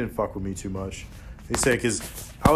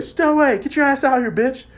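mock yell; 14 semitones higher, 5 dB louder than usual. Yelling at friend from car.

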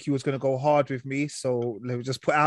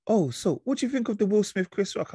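higher, curious coworker asking; nosy coworker voice; 8 semitones higher than usual. Retold or imitated. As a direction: ask it like a nosy colleague. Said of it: "people be like".

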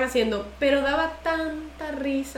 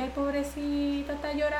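soft pity, imitated; 9 dB quieter than usual. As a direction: murmur it like a sympathetic old churchgoer. Old ladies' pity voiced again.

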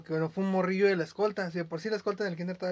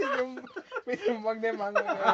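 high laugh then laughing words; 5 semitones higher than usual. Real, not acted. Telling about hitting his head at school, high laughing. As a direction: giggle through it.

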